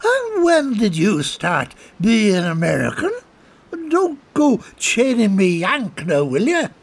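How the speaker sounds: put-on high, slow indignant drunk; tipsy Colm. Colm teasing the soldier, high and tipsy. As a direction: needle him like an offended drunk.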